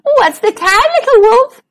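high, giggly little animal voices; high playful call. A narrator's reading. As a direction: chant it like gleeful mice. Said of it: "they giggled".

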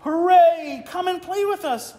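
high cheering cub voices; cheering otter cubs. Acted. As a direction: call out like excited pups. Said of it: "called all the other little otters".

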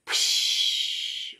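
a breathy unvoiced psh like spraying water; spray noise. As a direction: make the trunk's spray sound.

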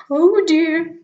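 a snake caught off guard; alarmed snake. Acted. Owl voice.

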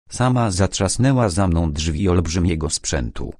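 usual speaking voice

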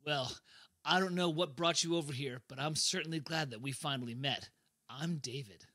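low, soft smooth talk; seductive. Acted. David introducing himself to the woman, seductive. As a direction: croon it softly, fading to an intimate low. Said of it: "seductive saxophone emotion".

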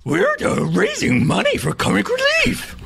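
Scooby-Doo impression. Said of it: "See, Scooby-Doo saying this".

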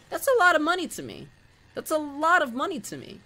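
high, loud upset cry; plead, voice pushed up. Real, not acted. Repeats, still heated.

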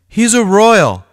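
high, loud alarmed discovery; he's shocked to see the gang name; startled realisation. A narrator's reading. Boy recognises the gang jacket.

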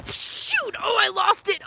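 a high, loud outburst at flubbing it; frustrated shout. Real, not acted. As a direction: yell at yourself for losing the flow. Loses the freestyle rap.

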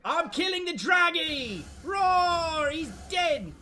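high, loud battle glee with a roar; triumphant yell. Pretend. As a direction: crow it as the dragon falls. Triumphant fight yelling.

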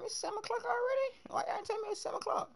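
squeaky high mock whine, a bit fast. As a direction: pitch it way up like a mocking whiner. Mock complaint about the time.

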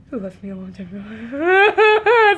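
hammed-up fake sob; mock crying. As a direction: pretend heartbroken wail. Mock lament over Miss Piggy.

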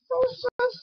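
high soft woof; playful puppy bark. Acted. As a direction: yip like a small puppy. Dog barks goodbye.

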